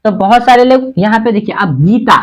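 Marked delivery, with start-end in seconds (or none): 1.8-2.2 Gita with a firm, long गी; stressed long sound. Shown as a demonstration. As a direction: lean on the first syllable of Gita. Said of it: "Gita पे जोर पड़ा ना भाई". (गीता, गी पर जोर देकर)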